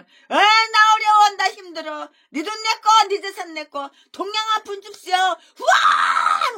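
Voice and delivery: high, loud, drawn-out whining mockery; mocking whiny pastor. Preacher mocks a greedy pastor begging, loud whining. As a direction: whine and wail like a greedy beggar, then blast out. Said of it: "하면 계속 지저대지".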